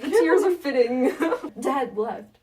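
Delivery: ordinary talk, nothing marked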